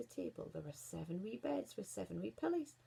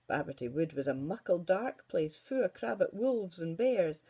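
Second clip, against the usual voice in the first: ordinary delivery, nothing marked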